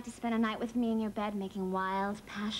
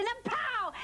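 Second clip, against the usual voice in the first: high, sudden exclamation on "pow"; heated outburst; 10 semitones higher than usual. Acted. Woman accusing Herman of betraying girls, heated outburst. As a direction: burst upward on the blow.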